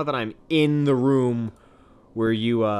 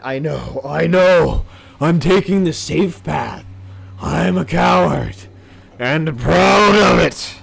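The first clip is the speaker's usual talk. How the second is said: higher, louder, slow put-on voice; mock proud; 4 semitones higher, 7 dB louder, slower than usual. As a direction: proclaim cowardice proudly. Defends taking the safe path.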